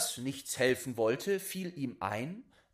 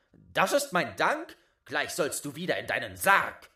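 louder, brisk indignant scolding; indignant boy; 3 semitones higher, 8 dB louder than usual. Acted. Boy scolds the ungrateful corpse, louder and brisk. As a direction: tell him off and shove him back.

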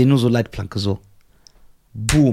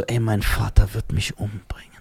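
whispered and lower, in mock dread; 6 semitones lower, 4 dB quieter than usual. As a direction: hush it like a scared kid. Retells fear of father, whispering.

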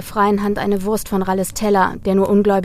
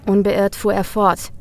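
ordinary delivery, nothing marked

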